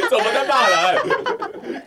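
words squeezed out through laughter; laughing. Real, not acted. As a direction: laugh while asking, slowly. Laughing at the insult.

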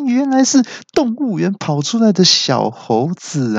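边笑边高声取笑; 笑着挖苦对手是小猴子; 笑着嘲讽. A narrator's reading. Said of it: "我就大笑说". (带笑的高声嘲讽)

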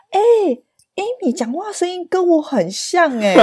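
friend's mother noticing her voice; imitating friend's mother. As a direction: savour it like a pleased mother. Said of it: "他妈就说".

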